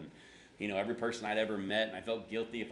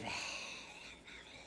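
long breathy exhale, no words; stuck breath; 3 semitones lower, 11 dB quieter than usual. Shown as a demonstration. Pastor illustrating failed prayer, wordless stuck sigh. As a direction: let the air out where words should be. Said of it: "the words just got stuck".

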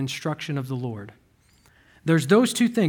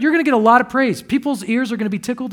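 normal talking voice

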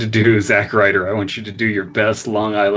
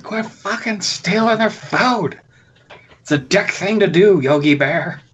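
park ranger; 9 semitones higher than usual. Acted. Ranger telling off Yogi Bear, high cartoon voice. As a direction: scold in a high put-on ranger voice.

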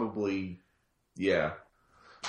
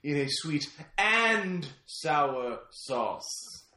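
slow savoring; much slower than usual. Pretend. Slow fancy menu addition.

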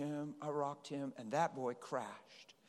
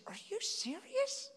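high, incredulous question; can't believe a stranger would help; amazed disbelief; slower than usual. Retold or imitated. Mother on the plane asks in high disbelief.

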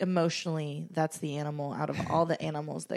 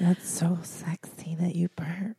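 breathy fake-sultry whisper, slower; mock seductive whisper; slower than usual. Mock-seductive remark on burp.

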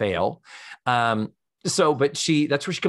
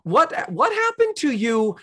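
incredulous; 3 dB louder than usual. Real, not acted. Exasperated appeal to character.

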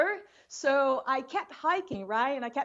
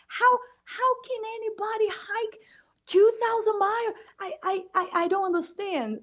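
incredulous whisper; 10 semitones higher than usual. Retold or imitated. To his German friend about the trail, a high whispered disbelief. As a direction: gasp it out as if the idea is absurd. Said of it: "I couldn't believe".

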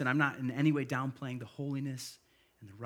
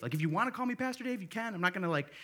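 animated high reassurance; 7 semitones higher, 3 dB louder, faster than usual. Retold or imitated. Pastor voicing himself to nervous guy.

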